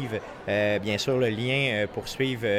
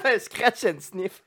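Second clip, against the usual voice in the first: laughing; 6 semitones higher, 4 dB louder, slower than usual. Real, not acted. Joking through laughter.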